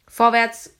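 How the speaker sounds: very loud shove of a word; guard pushing a prisoner forward; rough command. A narrator's reading. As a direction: push them on with one shout. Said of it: "sagte der eine und gab ihm einen Schubs".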